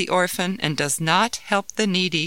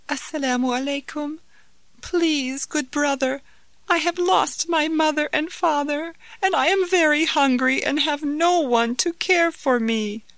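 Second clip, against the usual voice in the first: put-on young boy voice; 9 semitones higher than usual. Orphan boy begging.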